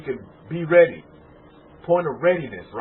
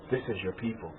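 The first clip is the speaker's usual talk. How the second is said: much softer, hushed quoting of a voice heard; soft inner voice; 9 semitones lower, 17 dB quieter, faster than usual. Retold or imitated. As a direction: murmur it like a voice from within. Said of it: "I heard a feminine voice say".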